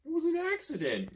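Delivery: protest. A narrator's reading. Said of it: "you protest".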